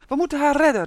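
high, loud call to action; exclaimed, loud and high; urgent cry. A narrator's reading. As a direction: cry out in alarm, pitched well up. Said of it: "riep hij".

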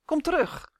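high, urgent hissed call; hissed command. A narrator's reading. Torg calls Justin back, hissing urgently. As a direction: hiss the order with sharp urgency. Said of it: "siste Torg".

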